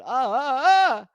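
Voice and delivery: escalating high "ah" yelps; rising cries. Pretend. Vlogger rushing through a speed run, rising yelps.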